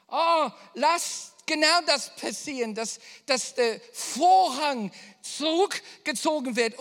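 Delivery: loud, high, building prayer; fervent prayer. Acted. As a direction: cry out for the wave to come. Prayer building to full force. (laute, inbrünstige Fürbitte)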